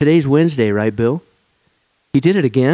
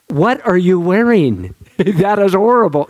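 mock insult; 6 semitones higher than usual. Mocking a friend's bad haircut, laughing sneer. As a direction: tease it like a snobby critic.

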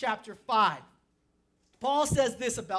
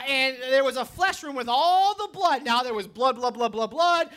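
ordinary talk, nothing marked

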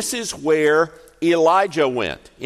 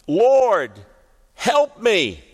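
high, loud cry for help; a mother's anguished plea; desperate plea; 8 semitones higher, 5 dB louder, much slower than usual. Acted. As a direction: beg him from the heart. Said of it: "And she says again".

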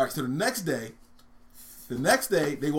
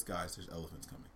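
quiet, low matter-of-fact delivery; understated Tarzan speaking normally; deadpan punchline; 8 semitones lower, 14 dB quieter than usual. Acted. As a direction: drop it softly as an anticlimax. Anticlimactic punchline after Tarzan's call.

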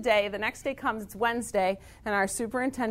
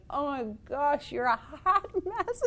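amused principal; 5 semitones higher than usual. Retold or imitated. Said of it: "principal is looking at me like".

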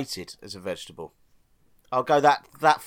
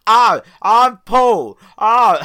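mocking impression of Paul, slow, high and loud; hammed-up stupid voice of a friend; 6 semitones higher, 9 dB louder, much slower than usual. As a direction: drag it out like a dopey mock Paul. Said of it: "I'll do my stupid voice".

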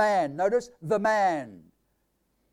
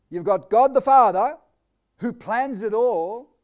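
usual speaking voice

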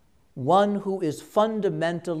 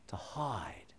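whispered; 3 semitones lower, 14 dB quieter than usual. Shown as a demonstration. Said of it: "preacher whispers it the second time".

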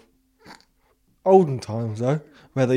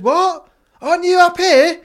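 high, louder startled question; startled child; 7 dB louder than usual. Retold or imitated. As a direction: ask in shock that she is not upstairs. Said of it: "And I said".

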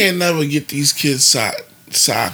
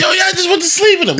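high-pitched incredulous retort, louder; mock outrage; 5 dB louder than usual. Parent reacting to kids who slept in their clothes, mock outrage. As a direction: exclaim it high, half laughing.

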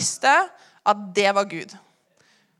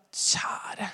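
unvoiced, much softer plea; a hushed whisper to God; whispered prayer; 9 dB quieter than usual. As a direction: breathe it as a secret prayer.

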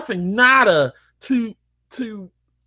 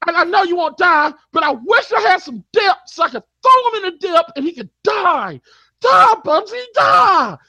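high, loud, shouting rant; furious rant; 10 semitones higher, 4 dB louder than usual. Real, not acted. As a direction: scream it at him, building. Ranting escalating about Bubsy.

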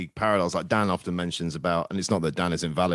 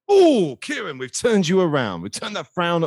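delighted; 8 semitones higher, 6 dB louder, slower than usual. Real, not acted. Delighted at a positive prediction.

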